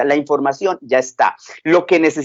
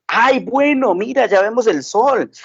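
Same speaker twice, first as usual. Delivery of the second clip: much higher, delighted praise; encouraging dad; 6 semitones higher than usual. Acted. Father excitedly encourages his daughter who sees the sun, much higher. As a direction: cheer her on like a proud papa.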